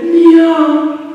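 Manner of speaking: high drawn-out meow; cat meow. Shown as a demonstration. Teacher showing the cat's sound, high long meow. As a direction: give a held cat's cry. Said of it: "Cat makes sound like".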